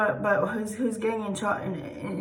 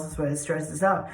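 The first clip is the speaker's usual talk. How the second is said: ordinary talk, nothing marked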